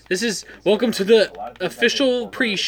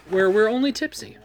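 ordinary talk

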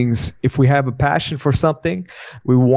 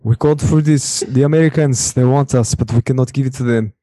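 put-on Russian accent. Shown as a demonstration. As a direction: do a mock Russian impersonation. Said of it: "my Russian accent".

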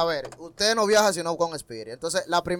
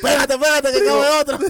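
loud, higher call of a crammed bus conductor; 7 semitones higher, 5 dB louder than usual. Retold or imitated. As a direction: shout it like a conductor packing people in. Imitating a bus conductor calling out. (cobrador de guagua gritando, voz alta y aguda)

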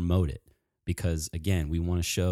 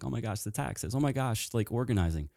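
higher, softer imitation of someone daunted; half-hearted little panic, pitched up; mock overwhelmed; 6 semitones higher, 3 dB quieter than usual. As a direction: lightly imitate a fretting beginner. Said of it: "they get overwhelmed by it".

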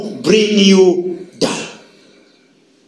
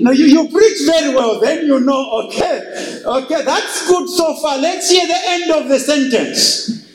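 a higher church critic rising to a shout; imitating a church member; 8 semitones higher than usual. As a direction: play the fussy listener grading the sermon. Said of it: "And they will say".

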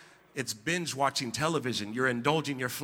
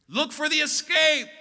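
preacher's shout; 13 semitones higher, 8 dB louder than usual. Real, not acted.

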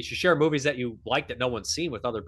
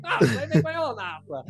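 mock Italian Fulci; 5 semitones higher than usual. Retelling the Fulci sketch, Italian boast building up. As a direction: build the boast like a proud old director. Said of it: "he's like".

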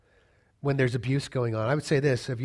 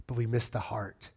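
ordinary talk, nothing marked

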